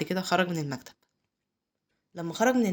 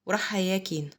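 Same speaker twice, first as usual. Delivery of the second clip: plain reading voice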